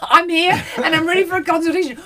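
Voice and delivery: high, laughing, hammed-up doctor; laughing mock doctor. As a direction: play a caring doctor through laughter. Playing Doctor Belinda, laughing.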